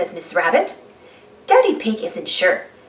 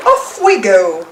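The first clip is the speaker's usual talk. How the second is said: slow, louder happy call; shout; 2 semitones lower, 5 dB louder, slower than usual. Acted. Daddy Pig shouts to his family as they set off. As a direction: launch it out over the water. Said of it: "shouts Daddy Pig".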